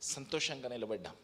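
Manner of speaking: ordinary talk, nothing marked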